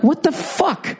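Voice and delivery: a high, disbelieving what the fuck; stunned outrage. Retold or imitated. At the woman who dented his car, high stunned disbelief. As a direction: act shocked at the rude neighbour. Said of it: "And I'm like".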